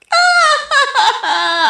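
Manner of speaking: high-pitched delighted giggle; coy squeal of a laugh. Acted. Shy reply to flirting.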